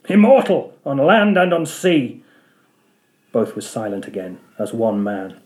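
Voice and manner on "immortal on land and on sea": higher, louder scornful repeat of Immortal; mocking echo. Ahab repeating his boast, derisive and higher. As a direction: throw the word back in triumph. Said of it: "with a laugh of derision".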